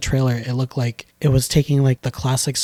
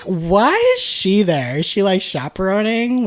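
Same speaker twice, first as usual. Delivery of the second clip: puzzled disbelief; 7 semitones higher than usual. Retold or imitated. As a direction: stretch the question up high in disbelief. Retelling own puzzled reaction to film.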